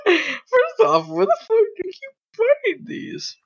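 high, squeaky voice through laughter; laughing. Real, not acted. Friend baffled by the videos found, laughing. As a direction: squeal it out while cracking up.

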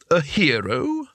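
scornful, heavy with meaning; a hint of sneering disbelief; loaded disdain. A narrator's reading. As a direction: pack contempt into one word. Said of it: "pack a lot of meaning into the one word".

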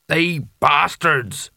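lightly voiced captain, very slow; ship's captain. A narrator's reading. Captain curses the slavers who left, slow character voice.

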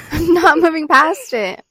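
high, loud, slow protest; acted stubborn refusal. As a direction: dig in loudly, drawing it out.

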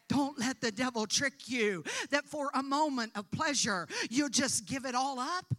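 loud, rising preacher's exhortation; preaching at full force. Acted. Preacher warning the congregation against the devil, at full force. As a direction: build the warning to a full pulpit cry.

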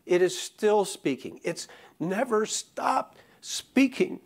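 slow, ringing pulpit proclamation; preaching at full force. Acted. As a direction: build the sermon to its peak with each phrase. Preaching builds to a climax.

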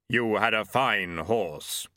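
slow, hollow voice of Death. Acted. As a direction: Death speaking gravely, slowly. Death speaks.

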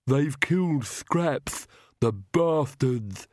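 slow, lisping henchman in grief; drawn-out lament of a lisping servant; grieving Igor. Acted. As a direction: mourn it slowly with a heavy lisp. Igor grieves over his dead dog.

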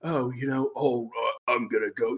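soft, low mock English accent; generic bad English accent. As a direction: imitate a bland English accent, quiet and low. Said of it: "like, kinda generic, bad, but generic".

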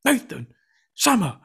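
high, slow mock teacher calling names; hammed-up stern teacher summons; summoning teacher. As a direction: call them into the office in a put-on teacher voice.

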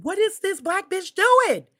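loud, slow, outraged yell at the screen; mock outraged shout. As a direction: yell at the dumb heroine in disbelief. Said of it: "I was like".

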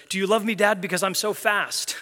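put-on child squirrel begging for praise; eager kid squirrel. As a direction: pipe up like an eager little kid. Kid squirrel voiced in story.

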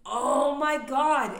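high, loud, slow oh my God; exasperated groan. Real, not acted. Fed up with the baggage crowd, slow exasperated groan. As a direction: let the frustration spill out.

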